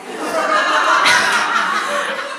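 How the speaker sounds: a long, airy, silent laugh; breathy laugh. Real, not acted. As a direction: let a soundless laugh escape. Laughing at her own story.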